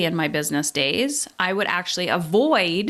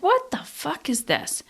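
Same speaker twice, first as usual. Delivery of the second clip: higher, exasperated "what the fuck is this"; fed up with herself, pitched high; irritated self-directed outburst; annoyed; 7 semitones higher than usual. Retold or imitated. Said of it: "now I'm just annoyed with myself".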